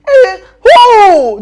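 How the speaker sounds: high, loud alarmed cry; startled outburst at the name Steve. Acted. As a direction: yelp in shock.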